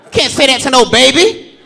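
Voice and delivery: normal talking voice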